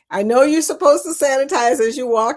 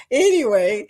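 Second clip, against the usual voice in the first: ordinary talk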